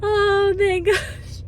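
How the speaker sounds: high voice cracking with happy tears; fighting back tears of joy; choked up with happiness; voice breaking. Real, not acted.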